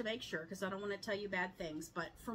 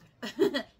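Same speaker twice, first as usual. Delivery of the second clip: burst of laughter; 6 semitones higher, 12 dB louder than usual. Real, not acted. Laughs at own joke.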